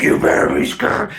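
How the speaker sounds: voiceless, threatening whisper; mock-sinister husband threatening his wife; menacing whisper. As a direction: hiss the threat at her. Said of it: "He's like".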